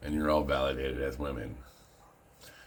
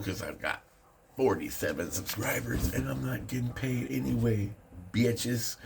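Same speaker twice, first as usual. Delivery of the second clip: sassy taunt; 9 semitones higher than usual. Acted.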